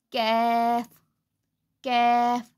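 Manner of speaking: कैफ़ thin and light, k from the tongue root; कैफ़: light k, thin vowel; Arabic kaf. Shown as a demonstration. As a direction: say कैफ़ light, not heavy. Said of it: "इसको बारीक पढ़ेंगे".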